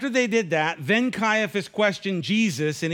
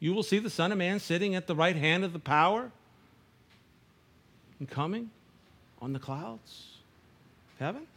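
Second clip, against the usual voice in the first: reading that dies to a hush; fading hush; 5 dB quieter than usual. Shown as a demonstration. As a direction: let the verse fade away into near silence. Rereading verse, fading to hush.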